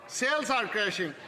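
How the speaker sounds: usual speaking voice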